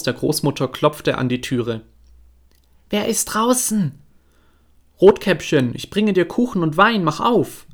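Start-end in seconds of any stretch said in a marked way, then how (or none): 2.9-4.0 put-on much higher, thin old woman's voice; old grandmother voice. Sick grandmother asks who knocks, high frail old voice. 5.0-7.7 put-on the wolf putting on a sweet girl's voice; wolf faking the girl. Wolf at grandmother's door, faking a higher girl's voice.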